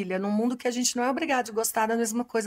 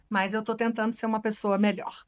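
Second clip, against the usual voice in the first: normal talking voice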